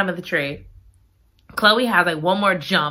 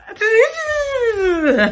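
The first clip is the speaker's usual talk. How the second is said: high, long cracking-up laugh; helpless laughter; 14 semitones higher than usual. Real, not acted. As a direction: lose it laughing at your own attempt. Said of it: "I can't do it".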